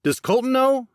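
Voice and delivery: high and loud, demanding; angry demand. A narrator's reading. Jackson, still furious, demands an answer from Max. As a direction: press hard for an answer. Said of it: "Jackson demanded".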